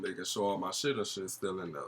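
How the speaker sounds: usual speaking voice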